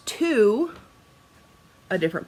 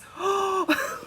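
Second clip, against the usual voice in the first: sharp high excited gasp; thrilled intake of breath; delighted gasp; 13 semitones higher than usual. Retold or imitated. Delighted reaction.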